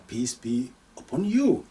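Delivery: usual speaking voice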